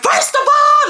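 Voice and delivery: high, loud screamed retort; screaming. Retold or imitated. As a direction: yell it from the back seat. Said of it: "I'm in the back of the car screaming".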